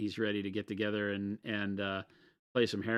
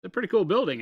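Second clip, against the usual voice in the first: louder, much higher and carrying; too loud indoors; 9 semitones higher, 8 dB louder, much faster than usual. Real, not acted. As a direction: blurt it out like an outside voice. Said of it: "Was that my outside voice?".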